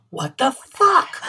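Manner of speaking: exaggerated baffled reaction; retold disbelief. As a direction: drag it out, stunned and half amused. Retold waking from dragon dream.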